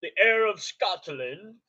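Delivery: slow, drawn-out fake Scottish accent; horrible Scottish accent. Streamer hyping Drew McIntyre, horrible Scottish accent. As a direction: announce it in badly faked Scottish. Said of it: "That was a horrible accent".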